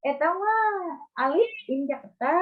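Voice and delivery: high, sing-song Sundanese imitation; playful Sundanese-accented voice. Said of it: "Pronounce-nya Sunda".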